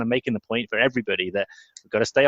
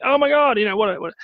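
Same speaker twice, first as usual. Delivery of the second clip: high, louder mock exasperation; exasperated; 12 semitones higher, 5 dB louder than usual. Coach watches a missed run out, mock exasperated. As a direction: groan it as a playful outburst. Fielder misses, extra runs conceded.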